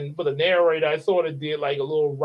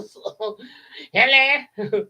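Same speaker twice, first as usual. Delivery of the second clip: lightly voiced Madea greeting; a hint of old Madea saying hello; 6 semitones higher, 4 dB louder, much slower than usual. [name] greets as Madea, a light put-on. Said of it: "a reboot of Madea".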